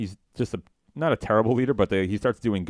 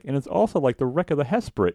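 high cartoon voice, a bit louder; imitating Homer; 8 semitones higher, 4 dB louder than usual. Homer jokes during the initiation, high voice. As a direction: pitch it up for the joke. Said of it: "the third one is like".

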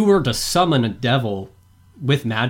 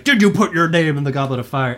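louder, urgent retold shout; mock scream; 2 semitones higher, 5 dB louder than usual. Imitating Dumbledore rushing over in the movie, mock screaming. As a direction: fire the question out in mock alarm. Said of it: "rushes over and screams".

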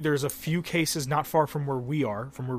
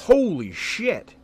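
slow, higher, louder holy shit; stunned; 6 semitones higher, 6 dB louder, much slower than usual. Real, not acted. Hearing that the NBA season is suspended, slow and stunned. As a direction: drag it out in amazement.

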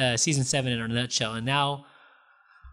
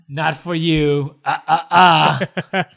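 slow, drawn-out teasing no; hammed-up finger-wag of a voice; mock refusal; 6 dB louder, much slower than usual. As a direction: scold playfully, ending on loud separate beats.

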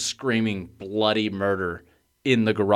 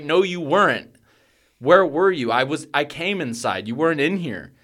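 high, insistent, raised voice; retold exasperated arguing; 6 semitones higher, 4 dB louder than usual. Parent contradicting the child's story, exasperated. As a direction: argue back high and disbelieving.

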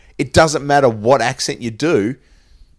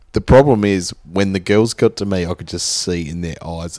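ordinary talk, nothing marked

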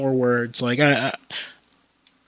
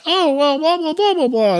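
high, louder sing-song blah blah; mock snooty customer; mocking dismissive; 13 semitones higher, 3 dB louder than usual. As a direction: brush him off in a mocking high voice. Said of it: "they're like".

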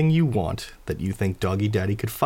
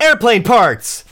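very high, very loud, slow cry; emphatic shout; 12 semitones higher, 10 dB louder, slower than usual. Acted. Fads stands up to announce it, an emphatic shout. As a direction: belt it out, stretching both words.

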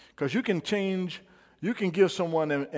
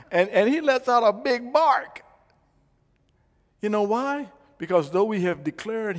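loud, high excited preaching; 5 semitones higher, 9 dB louder than usual. Acted. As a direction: build it up from the pulpit. Preaching story at full force.

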